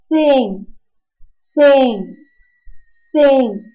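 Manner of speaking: "thin" with the tongue between the teeth; th sound. Shown as a demonstration. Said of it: "它都要吐出舌头".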